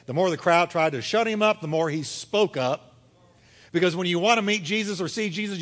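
ordinary talk

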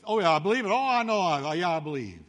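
glib believer. Retold or imitated. Preacher mimics a glib believer.

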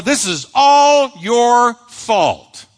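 a mock spouse's heavy accusation; blaming. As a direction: lay the blame on your spouse, slowly. Acted marriage quarrel, blaming.

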